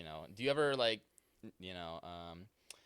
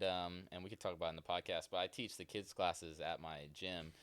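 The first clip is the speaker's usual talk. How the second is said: usual speaking voice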